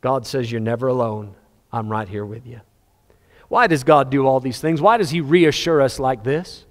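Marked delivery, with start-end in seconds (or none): 0.5-2.6 softer, hushed promise; almost whisper it close to the ear; soft reassurance. Acted. God tells the lonely believer they are never alone, hushed.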